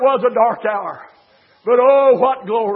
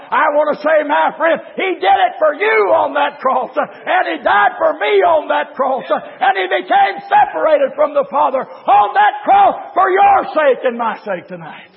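raised, urgent revival preaching; full-force preaching; 4 semitones higher than usual. Real, not acted. Preacher tells friend who Christ died for, full-force preaching. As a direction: drive it home, then drop at the close.

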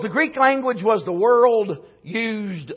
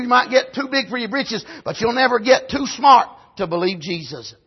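normal talking voice

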